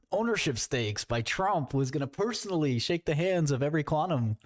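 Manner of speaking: ordinary talk, nothing marked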